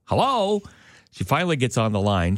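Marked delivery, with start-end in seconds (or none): 0.0-0.6 high, questioning hello that drops off; repeated phone hello. Retold or imitated. Teller re-enacting answering a telemarketer's call, high expectant hello. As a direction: call hello into a silent line. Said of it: "you say hello a couple of times".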